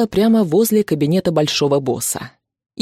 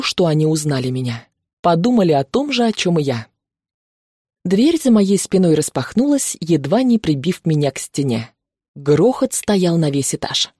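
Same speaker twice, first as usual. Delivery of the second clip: ordinary delivery, nothing marked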